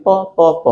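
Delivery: "প" repeated as separate syllables; pronunciation demo of the opening "প"; sound demo. Shown as a demonstration. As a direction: say "প, প, প", each one distinct. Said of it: "দেখো যখন উচ্চারণ করছি".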